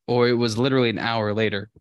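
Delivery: ordinary delivery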